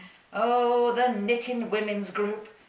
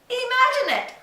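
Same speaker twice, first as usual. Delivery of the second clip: delighted wonder; 12 semitones higher, 3 dB louder than usual. Acted. Marvelling at a million marchers.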